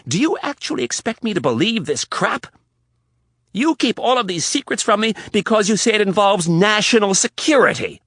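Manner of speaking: high, loud, building tirade. Acted. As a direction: let it build to a shout. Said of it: "Riley was getting louder".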